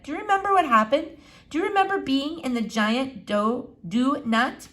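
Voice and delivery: put-on a touch of a cross young girl; Flora. A narrator's reading. Girl insisting to the doctor, lightly voiced and impatient.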